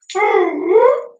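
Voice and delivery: high, wild, unhinged laugh; crazy voice laugh. Shown as a demonstration. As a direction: cackle like a madman. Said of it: "That's my crazy voice".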